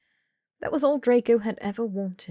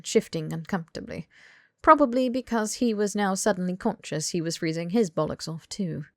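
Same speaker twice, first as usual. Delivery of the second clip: plain reading voice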